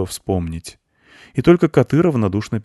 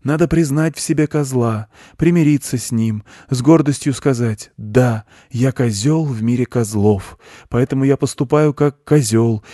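raised, earnest lecturer's voice; preaching goat; 5 semitones higher, 4 dB louder than usual. A narrator's reading. Goat explains his doctrine to a boy, preaching. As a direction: expound it like a guru, pitched up.

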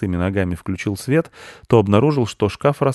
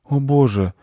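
high, slowing, dazed question; lightly voiced groggy father; sleepy dad; 7 semitones higher, slower than usual. A narrator's reading. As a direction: ask as if just woken by a crash. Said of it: "спросил сонный папа мальчика".

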